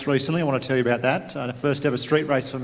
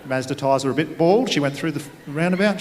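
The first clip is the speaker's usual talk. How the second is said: ordinary talk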